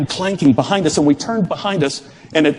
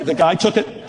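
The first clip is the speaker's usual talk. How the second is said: usual speaking voice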